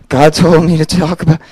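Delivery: loud, wobbling mock-terrified voice; nervous shaking voice. Nervous speaker stepping up to preach, shaking. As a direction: act out the shakes, wavering on every word.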